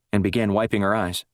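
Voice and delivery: usual speaking voice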